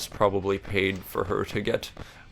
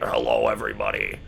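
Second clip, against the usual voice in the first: much lower, deep YouTuber greeting; Markiplier intro; 12 semitones lower than usual. Pretend. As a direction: drop way down and open the show like Markiplier. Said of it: "That's my Markiplier impression".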